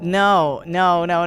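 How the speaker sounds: normal talking voice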